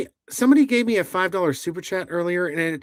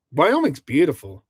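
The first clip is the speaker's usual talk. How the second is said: ordinary talk, nothing marked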